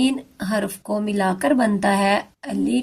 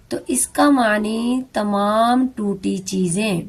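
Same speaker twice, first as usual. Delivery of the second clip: ordinary delivery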